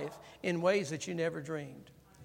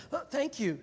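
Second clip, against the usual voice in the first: put-on child thanking; 7 semitones higher than usual. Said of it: "What do you say?".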